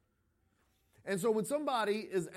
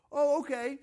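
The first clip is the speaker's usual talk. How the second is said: higher, louder sarcastic "okay"; mock anxious reply; 8 semitones higher, 6 dB louder than usual. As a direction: act out the scared person pretending to be calmed. Mock reply of scared person.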